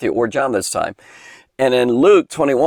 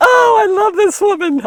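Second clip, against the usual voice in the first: gushing delight; 4 dB louder than usual. Real, not acted. Teacher gushing over the widow.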